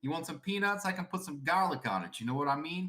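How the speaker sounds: mock Kentucky-meets-Boston holler; joking Kentucky-Boston twang; Kentucky-Boston accent. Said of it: "Kentucky man that was originally born in Boston".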